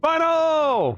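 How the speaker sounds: high, loud happy call-out; excited shout. Real, not acted. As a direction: call the name out with joy. Greeting a chat donor.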